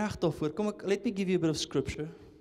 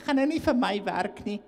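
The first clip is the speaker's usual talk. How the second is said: higher, loud whiny refusal; mock lazy believer; 8 semitones higher, 7 dB louder than usual. Preacher mocks a believer refusing God's command, whiny refusal.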